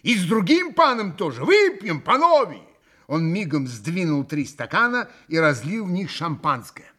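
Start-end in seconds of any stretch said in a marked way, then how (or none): 0.0-2.6 loud, high rallying call; крик. A narrator's reading. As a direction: cry out a toast invitation, building as you go. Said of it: "крикнул Митя". (громко зазывая выпить)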